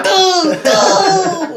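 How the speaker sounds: high, loud cry through laughter; hammed-up high wail, laughing; mock climax cry.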